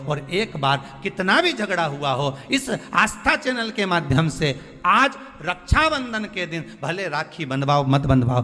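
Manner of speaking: ordinary talk, nothing marked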